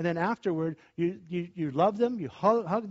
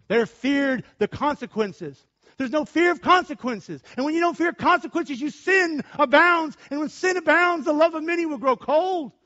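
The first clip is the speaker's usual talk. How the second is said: higher, building sermon delivery; rising preaching; 9 semitones higher, 6 dB louder than usual. Real, not acted. Pastor warning the congregation that love grows cold. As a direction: warn them with mounting force.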